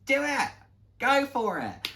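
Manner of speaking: a colleague urging her to go for it; excited encouragement. Retold or imitated. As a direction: hype up a friend who's nervous. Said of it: "She was like".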